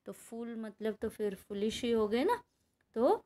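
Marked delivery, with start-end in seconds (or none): none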